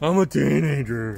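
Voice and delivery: put-on loud mopey teen voice; lightly mocking sulky teen; teenager voice. Shown as a demonstration. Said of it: "Is that your teenager voice".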